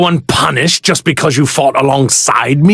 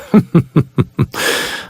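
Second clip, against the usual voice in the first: breathy villain laugh. Acted.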